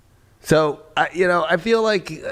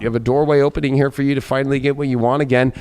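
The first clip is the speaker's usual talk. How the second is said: ordinary delivery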